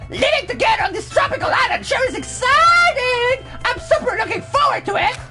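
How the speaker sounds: very high, bubbly idol voice; squeaky J-pop voice. Acted.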